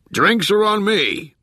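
high, drawn-out yell to the whole crew; jovial yell. A narrator's reading. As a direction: call it out long and high across the room. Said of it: "he yelled".

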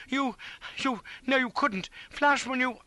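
high, broken disbelief; aghast stammering. A narrator's reading. East horrified, reeling in his seat.

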